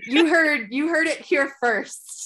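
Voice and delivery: hammed-up breaking news announcer; mock news anchor.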